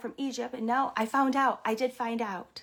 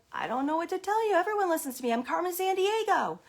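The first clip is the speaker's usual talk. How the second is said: higher, swaggering self-importance; boastful retort; 6 semitones higher, 4 dB louder than usual. Retold or imitated. Carmen Sandiego answering impressed teachers, boastful retort. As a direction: proclaim it like a star stating the obvious.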